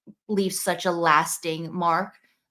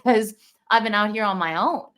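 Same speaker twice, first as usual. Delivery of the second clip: ordinary talk, nothing marked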